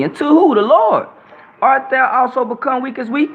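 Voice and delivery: fiery preaching. Real, not acted. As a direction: preach it hard to the crowd. Preaching at full force.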